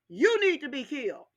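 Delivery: ordinary talk, nothing marked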